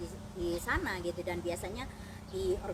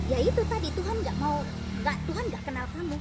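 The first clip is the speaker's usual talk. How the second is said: louder, higher, insistent; retold firm rebuke; 6 semitones higher, 5 dB louder than usual. Narrator rebukes the crying woman about God, retold firm rebuke. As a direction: push the point home forcefully.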